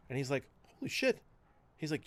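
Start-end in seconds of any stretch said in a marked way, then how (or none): 0.8-1.2 higher, stunned exclamation; retelling the soldier's stunned reaction; shocked. Retold or imitated. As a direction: gasp it out in disbelief. Retold shock at meeting her.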